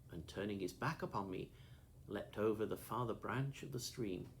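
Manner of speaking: ordinary delivery, nothing marked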